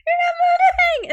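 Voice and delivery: high, loud mock screech; imitated yell. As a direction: yell it like an indignant woman. Retelling woman shouting in clip.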